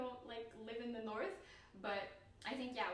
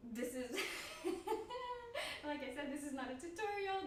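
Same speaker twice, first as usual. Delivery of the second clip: laughing; 8 semitones higher, 3 dB louder than usual. Real, not acted.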